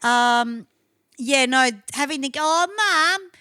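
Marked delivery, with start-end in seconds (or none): 2.4-3.3 put-on higher nagging child whine; whiny kid voice. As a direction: nag Mum like a brat.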